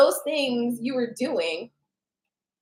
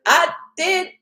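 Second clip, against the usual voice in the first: mock angry; 6 semitones higher, 4 dB louder, slower than usual. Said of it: "you probably shouldn't do that".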